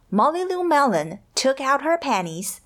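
ordinary delivery

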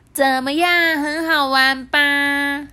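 high, loud, stretched-out delight; gleeful. A narrator's reading. Penguin asks if it was fun, gleeful. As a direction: crow happily, drawing out each phrase. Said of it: "也跟着高兴地说".

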